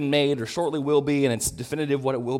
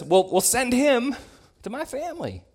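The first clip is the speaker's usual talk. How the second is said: mock childish rich man; 10 semitones higher than usual. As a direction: play him like a spoiled child asking. Mocking the rich man's childish request.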